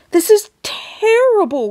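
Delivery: high wailing voice. A narrator's reading. Mother wailing over her shrunken son, high wail. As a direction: wail it in distress. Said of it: "wailed Missus T V".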